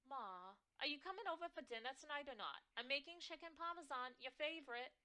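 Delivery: fast, high, loud mom; pushy New York mother. Acted. Mother pressing her daughter to come for dinner, fast and shrill. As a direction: rush the dinner invite. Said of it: "My mother's shrill, New York-tinged voice".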